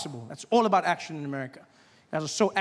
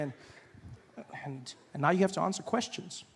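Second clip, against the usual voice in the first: normal talking voice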